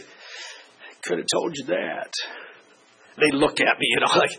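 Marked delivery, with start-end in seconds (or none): 0.0-2.1 hushed, slow, knowing; soft told-you-so. Retold or imitated. Father to kids after bad result, soft and knowing. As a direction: say it softly, almost under breath. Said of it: "I'd say".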